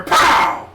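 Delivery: loud punchy pow; mock gunshot. Retelling the cop shooting the deer, loud gunshot pow. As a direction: blast it out like a gunshot.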